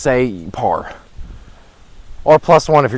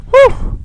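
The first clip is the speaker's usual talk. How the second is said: whew of relief; 5 dB louder than usual. Real, not acted. Relief after the drive.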